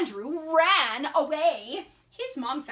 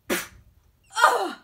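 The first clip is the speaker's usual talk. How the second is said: high, loud spit noise; imitated spitting; 7 semitones higher, 6 dB louder than usual. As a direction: act out spitting on your hand.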